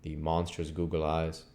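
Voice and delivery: ordinary delivery, nothing marked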